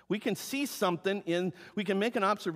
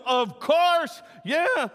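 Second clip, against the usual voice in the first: eager yes; 10 semitones higher, 8 dB louder, much slower than usual. Retold or imitated. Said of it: "He doesn't go".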